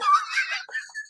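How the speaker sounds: a squealing burst of laughter; high-pitched laugh. Real, not acted. Laughs at his own mama joke to listeners.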